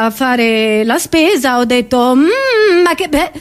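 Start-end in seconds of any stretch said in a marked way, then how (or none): none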